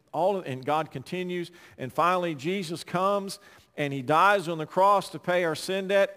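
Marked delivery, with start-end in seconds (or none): none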